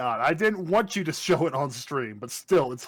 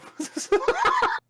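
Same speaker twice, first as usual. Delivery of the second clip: high laugh. Real, not acted. Laughing at fan comment.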